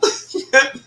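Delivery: high-pitched amused laugh; sudden delighted laugh. Real, not acted. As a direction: burst out laughing. Laughing at a viewer's comment.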